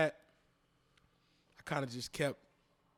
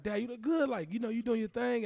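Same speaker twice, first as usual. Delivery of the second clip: higher, lightly voiced kid praising dad; a hint of his little son; 8 semitones higher than usual. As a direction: lightly mimic his son cheering him on. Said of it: "My little man be like".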